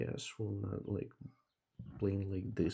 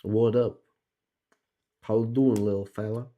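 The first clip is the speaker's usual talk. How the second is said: shouted friendly hello; loud greeting; 10 dB louder than usual. Real, not acted. Shouting a greeting to the little fella in game, loud call.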